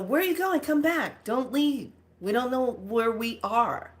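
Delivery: high urgent call, easing down as it goes; mock alarmed calling. Dreamer calling young one back down hallway, mock alarm. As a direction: call after someone running off, pitched high.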